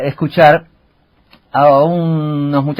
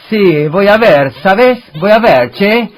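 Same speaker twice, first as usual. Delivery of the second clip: higher, louder, slow Italian-style lilt; mock Italian accent; 7 semitones higher, 4 dB louder, slower than usual. Joking about translated Italian songs, in a hammed-up Italian accent. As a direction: ham up an Italian-Argentine singsong. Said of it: "Pero con el acento italiano".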